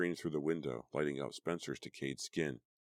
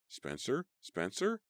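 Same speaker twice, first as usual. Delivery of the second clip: calling his name higher and higher; rising frantic cry; 9 semitones higher, slower than usual. A narrator's reading. Girl calling her dead friend, rising panic. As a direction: call out in growing panic, pitch climbing. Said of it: "I cried".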